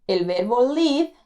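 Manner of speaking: usual speaking voice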